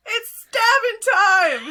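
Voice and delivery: high, loud battle cry; excited shout. Acted. Gemini gets first dibs on the battle, shouting excitedly. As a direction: shout it out, unable to contain yourself. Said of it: "He shouts".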